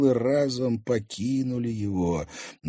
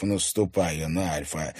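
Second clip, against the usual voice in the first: usual speaking voice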